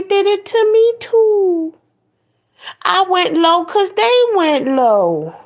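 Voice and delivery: high, whiny mock excuse. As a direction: whine it like a kid making excuses. Mocking people's excuses.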